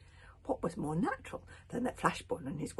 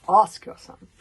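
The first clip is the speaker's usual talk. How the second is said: much louder shouted demand; loud accusing repeat; 21 dB louder than usual. A narrator's reading. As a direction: hurl it at him.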